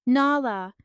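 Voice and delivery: a loud, breaking cry of her name; desperate hysterical shout of her name. A narrator's reading. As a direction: call out to her in sheer panic. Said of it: "he cried hysterically".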